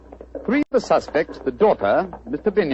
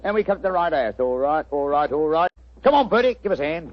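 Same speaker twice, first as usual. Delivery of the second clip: chirpy cockney delivery pitched up; cockney disguise. Acted. Disguised detective greets the homeowner in cockney. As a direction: play a cheeky London workman. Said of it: "What barbaric jargon do you speak?".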